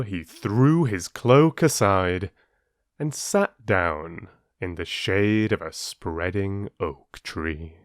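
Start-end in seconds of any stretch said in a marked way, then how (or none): none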